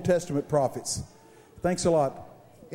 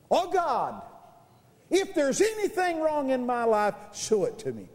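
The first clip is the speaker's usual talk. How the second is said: retold prayer; 6 semitones higher than usual. Preacher re-enacting his office prayer, lightly prayerful. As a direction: lift it up to God, then let it fall. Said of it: "I said a prayer like this".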